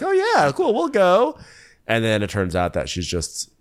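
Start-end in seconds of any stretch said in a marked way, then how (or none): 0.0-1.4 much higher, airy singsong yes; mock eager agreement. The group eagerly accepting the fake tour invite, mock chirpy. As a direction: chirp it like a gullible fan. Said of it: "everyone's like".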